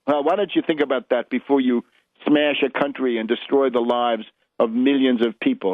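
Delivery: usual speaking voice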